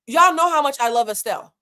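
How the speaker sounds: ordinary talk, nothing marked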